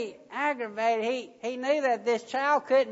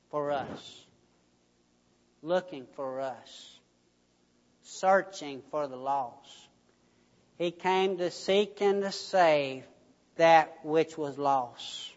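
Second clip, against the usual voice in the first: solemn, tender; 5 semitones lower than usual. Real, not acted. Solemn climax of sermon.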